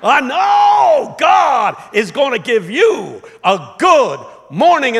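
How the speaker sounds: high, slow, drawn-out shout; preacher's climax. Retold or imitated. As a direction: belt it out as a soaring funeral proclamation.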